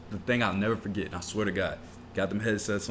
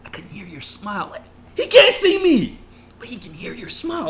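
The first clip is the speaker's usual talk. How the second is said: mocking the boss; 13 semitones higher, 7 dB louder than usual. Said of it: "mocking the boss again".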